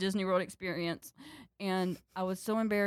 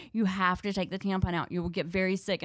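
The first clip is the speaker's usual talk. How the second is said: ordinary talk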